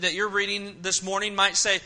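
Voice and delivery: normal talking voice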